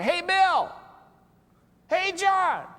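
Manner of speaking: high, loud friendly hail across the room; bright casual greeting called out loud; calling out. Retold or imitated. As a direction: shout a cheerful hello to a buddy. Friends greeting by first name.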